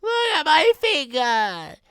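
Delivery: high loud scream. Acted. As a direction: scream it in shock. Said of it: "He was screaming".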